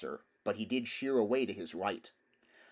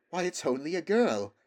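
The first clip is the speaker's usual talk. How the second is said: put-on higher, louder surprised boy; exclaiming; 7 semitones higher, 5 dB louder than usual. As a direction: blurt it in astonishment. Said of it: "he exclaimed".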